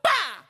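imitated slap.

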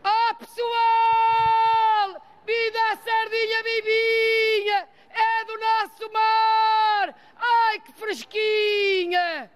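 high, drawn-out market vendor's cry; shrill fishwife sardine cry. Shown as a demonstration. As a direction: belt it out like a fish seller hawking sardines. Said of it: "mas eu vou pregoar".

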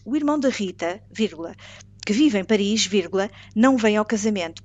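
dictate, saying each comma aloud; dictating. Shown as a demonstration. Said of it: "Frase dois".

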